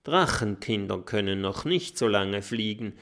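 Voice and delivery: usual speaking voice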